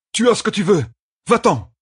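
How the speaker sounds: high, urgent yelled demand; desperate shout. Acted. Hero ordering the killer away from his friend, desperate shout. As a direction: yell at the enemy, pitch way up.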